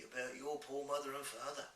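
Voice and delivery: big friendly giant with odd, homely speech. Acted. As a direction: play a kindly giant fretting over a child. Voices the giant.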